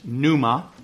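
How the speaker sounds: pneuma said slowly, p and n both sounded; Greek word pneuma. Shown as a demonstration. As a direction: carefully spell out pneuma. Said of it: "there's a word for spirit".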